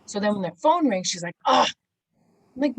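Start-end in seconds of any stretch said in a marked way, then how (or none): 1.5-1.7 loud, short groan of dread; exasperated groan. Retold or imitated. Assistant groaning as the phone rings, exasperated. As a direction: imitate a fed-up "ugh".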